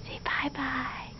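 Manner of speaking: breathy whisper, slow "Say bye-bye". Shown as a demonstration. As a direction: coax "bye-bye" in a hushed whisper. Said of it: "You say".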